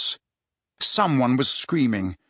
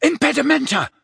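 high-pitched urgent incantation; yelled spell; 13 semitones higher, 3 dB louder than usual. A narrator's reading. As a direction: cast it with a sudden high yell. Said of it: "he yelled as he rolled over".

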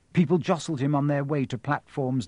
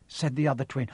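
ordinary delivery, nothing marked

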